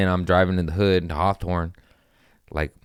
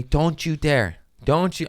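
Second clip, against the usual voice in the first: mock mom yell; 8 semitones higher, slower than usual. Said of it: "She's yelling at me".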